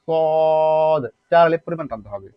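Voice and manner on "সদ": সদ with the vowel held for four beats; long steady vowel in সদ; elongated vowel. Shown as a demonstration. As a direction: stretch সদ on one level note. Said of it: "উচ্চারণটা এই দালের মতো".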